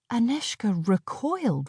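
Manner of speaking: plain reading voice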